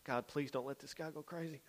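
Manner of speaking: soft, urgent little plea; a quick private aside to God; under-breath prayer. Retold or imitated. Leader facing a postured-up teen, hushed plea.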